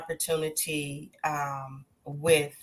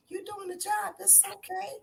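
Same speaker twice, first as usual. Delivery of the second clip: put-on much higher, soft, sweet old-lady voice; imitating sweet grandmother; 10 dB quieter than usual. Daughter quoting a gentle grandmother's blessing. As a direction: gently reassure like a kind grandmother. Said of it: "she was like".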